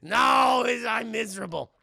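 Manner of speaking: hammed-up miserable wail; loud, acted anguish; mock crying. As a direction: wail loudly like someone pitying himself. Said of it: "He cries so much".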